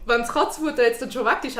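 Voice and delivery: normal talking voice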